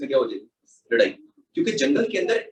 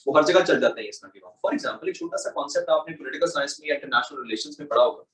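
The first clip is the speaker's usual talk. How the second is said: ordinary talk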